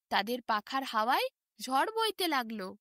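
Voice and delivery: plain reading voice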